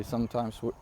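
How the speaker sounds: usual speaking voice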